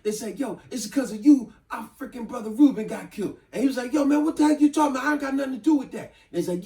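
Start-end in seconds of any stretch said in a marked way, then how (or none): 0.4-3.4 retold accusation. Brothers accuse Kurt. 3.9-6.1 fast, high, panicked protest; frantic denial. Retold or imitated. Kurt protests innocence.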